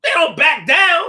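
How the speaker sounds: high, loud call that they stand firm; emphatic shout. Real, not acted. Telling viewers men stand their ground, loud emphatic shout. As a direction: shout it out with full force.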